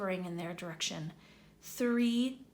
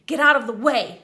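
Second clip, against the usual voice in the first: much louder angry snap; snapping; 3 semitones higher, 13 dB louder than usual. Acted. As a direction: snap at the poltergeist. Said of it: "snapped Ron".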